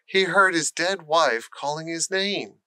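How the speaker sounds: ordinary delivery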